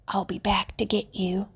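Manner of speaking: hushed secret promise; whisper. A narrator's reading. Boy secretly promising the rabbit, whispered. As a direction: whisper so the woman can't hear. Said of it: "he said to Edward".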